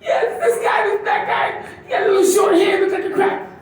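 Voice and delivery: high-pitched, upset mother's voice; shrill mom voice. Retold or imitated. Comic's mom telling him about robbery, high and upset. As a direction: squeal it like a shaken mom.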